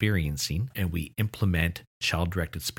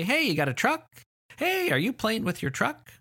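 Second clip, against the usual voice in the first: put-on high, eager child-directed questions; adult talking to a child. Adult asking a child about the obvious truck, high singsong voice. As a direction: pitch way up for a toddler. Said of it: "Or maybe you say".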